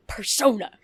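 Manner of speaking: a hammed-up dramatic "Persona."; mock dramatic. Guest mocking his own way of saying persona. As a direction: say the name like a grand announcement. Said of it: "I just have this moment where I'm like".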